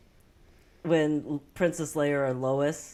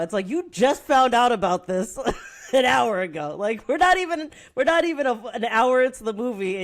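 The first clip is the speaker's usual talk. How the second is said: laughing; 5 semitones higher, 6 dB louder than usual. Real, not acted. Laughing rant at the movie.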